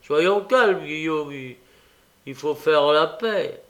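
lightly voiced appeasing figure; placating old schemer. A narrator's reading. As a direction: try to smooth things over, haltingly. Old man placates the brute.

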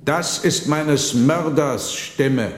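a murdered ghost naming his killer; bloody apparition calling out slowly; ghost's accusation. Acted. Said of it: "rief eine fürchterliche Gestalt".

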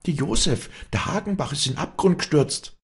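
very high, urgent cry; screamed alarm. A narrator's reading. Walli tells villagers of the fall, crying out high. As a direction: cry the news out in panic. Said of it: "schrie Walli".

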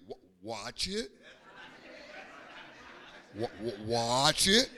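stammered, teasing warning with rising end; mock warning. Jesus cautioning boastful Peter, stammered mock warning. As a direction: warn him, tripping on the first word.

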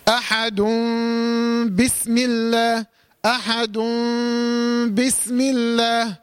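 احد recited as "ahadum", the m held through the nose; iqlab with ghunna. Shown as a demonstration. Teacher showing how to pronounce احد before بسم الله, nasal held m. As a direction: slowly join احد to بسم الله with a long hummed m. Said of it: "هنطقها ازاي؟".

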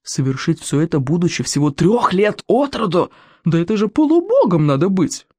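marvelling, awestruck gossip; muted awe. A narrator's reading. People marvel at a three-year-old hero's feats, muted awe. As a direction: build from hushed respect to amazement. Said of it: "шептались люди с приглушённым уважением".

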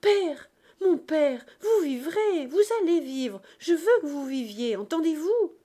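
high, loud, desperate pleading; heart-rending cry. A narrator's reading. Cosette begging her dying father to live, crying out. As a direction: cry out and command him to live. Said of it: "Cosette poussa un cri déchirant".

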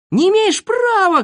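high, loud protest; indignant yell. A narrator's reading. Boy dragged from under bench protests, yelling. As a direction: shriek your objection. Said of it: "кричал Незнайка".

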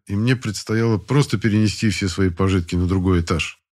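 ordinary delivery, nothing marked

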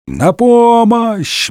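high, long-drawn cry; bawling for help. A narrator's reading. Porthos drowning in the moat, bawling. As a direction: wail it out, stretching the vowels. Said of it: "благим матом орал бедный Портос".

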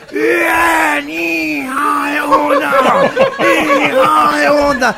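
grito nasal y alargado; multitud de gangosos. Acted. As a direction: corea lento, imitando a los gangosos. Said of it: "todos como cinco mil gangosos". (ovación gangosa arrastrada)

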